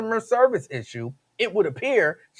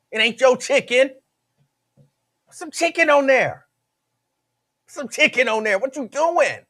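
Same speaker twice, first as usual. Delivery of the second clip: raised, exasperated griping at the server; hammed-up annoyed customer; mock complaint; 7 semitones higher than usual. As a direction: complain about skimpy chicken, pitched up. Acting out complaint at Chipotle.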